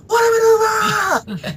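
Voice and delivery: high, slow, drawn-out announcer cry; Vince McMahon impression. Retelling CM Punk mocking Vince on commentary, high drawn-out cry. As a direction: stretch it out like a hammed-up chairman. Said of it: "He was like".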